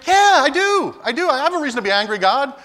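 high, loud defiant yell; angry shout. Acted. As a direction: shout your right to be angry. Jonah defying God.

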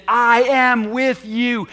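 every word of "I am with you" loud, high and slow; emphatic. Shown as a demonstration. Preacher repeating Jesus' promise to the church, slow and emphatic. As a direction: lean hard and long on each word, "I am with you". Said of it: "he emphasized".

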